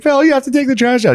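high, loud nagging shout; imitating mom shouting at dad about the trash; mom yelling. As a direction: yell it up high like an annoyed mom.

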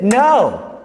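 high, loud, drawn-out correction; imitated sister's forceful command; emphatic interruption. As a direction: cut in like a stern nun. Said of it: "she just interrupted".

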